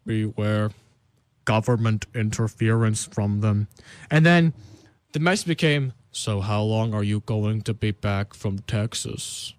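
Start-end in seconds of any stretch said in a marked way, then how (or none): 0.0-3.7 ominous message voice. Retold or imitated. Reading out a mysterious message. 6.1-9.5 low, slow eerie warning; ominous message voice. Retold or imitated. Reading out a mysterious message.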